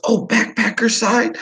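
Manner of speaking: high, eager broken English; imitated Vietnamese English. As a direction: coax the guest to eat. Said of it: "He goes".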